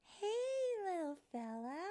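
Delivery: high, loud singsong greeting; coaxing fairy. Acted. Fairy to the baby bird, high and coaxing. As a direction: call sweetly to a little bird.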